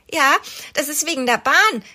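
stolzer kleiner Junge. A narrator's reading. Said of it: "erklärt Oskar stolz".

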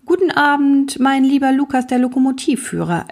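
put-on a kindly old king welcoming his friend; king's voice.

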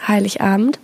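ordinary delivery

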